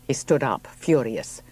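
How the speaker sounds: usual speaking voice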